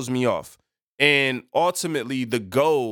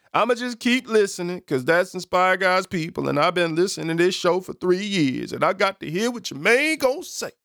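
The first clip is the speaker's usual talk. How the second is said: higher put-on voice of a devoted listener; put-on fan voice; 5 semitones higher than usual. Host voicing a loyal listener who keeps tuning in, higher put-on voice. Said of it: "Why I did it in that voice".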